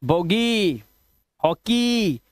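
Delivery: बोगी and हॉकी with a held "ee" at the end; long "ee" endings. Shown as a demonstration. Teacher showing the EY rule, long "ee" ending बोगी, हॉकी. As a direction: stretch the last vowel of बोगी, हॉकी into "eee". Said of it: "वो ई करना है".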